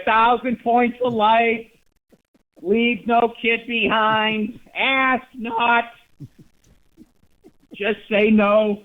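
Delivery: slow, high, hammed-up campaign speech; politician slogans. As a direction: drag out the slogans like a stump speech. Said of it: "the other person just gets up there".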